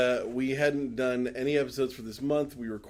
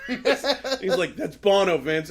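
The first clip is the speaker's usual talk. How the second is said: mock [name]; 7 semitones higher, 8 dB louder, much slower than usual. [name] voiced in retold scene.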